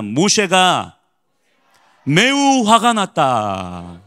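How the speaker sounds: slow, drawn-out recital; lead for repetition. Shown as a demonstration. Preacher leading congregation in reading verse fifteen, slowly drawn out. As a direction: stretch it out for the congregation. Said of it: "따라합니다".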